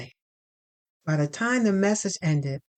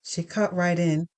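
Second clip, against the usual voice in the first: usual speaking voice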